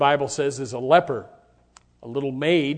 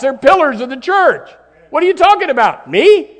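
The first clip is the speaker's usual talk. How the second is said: higher, louder, offended protest building up; indignant churchgoer; 9 semitones higher, 9 dB louder than usual. Acted. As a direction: act out an outraged sinner who won't admit it. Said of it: "they get so upset when you tell them".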